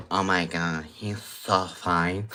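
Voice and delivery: lightly put-on swooning impression, low and soft; mock gushing, stretched out slowly at the end; hushed mock swoon; mocking "oh my God" voice. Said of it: "have this, like, "Oh my God" voice".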